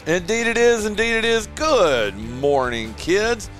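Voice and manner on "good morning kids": ordinary talk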